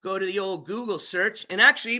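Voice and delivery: ordinary delivery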